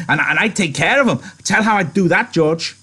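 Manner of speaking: eager Lenny. Acted. Lenny begging George to describe the rabbits, eager. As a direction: plead like an excited child.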